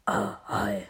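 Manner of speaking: very low, quiet grunt of a big man; Bull voice. Acted. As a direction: drop your voice way down for a hulking brute. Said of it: "mein Mann kommt".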